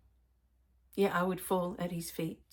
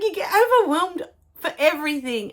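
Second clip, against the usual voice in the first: higher, louder playful teasing as the daughter; 9 semitones higher, 9 dB louder than usual. Retold or imitated. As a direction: lightly voice a daughter ribbing her mum. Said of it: "She's like".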